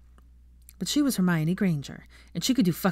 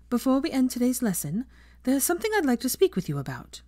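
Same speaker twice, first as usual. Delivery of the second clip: a touch higher, brisk classroom address; a professor ending her lesson, lightly voiced teacher; 7 semitones higher than usual.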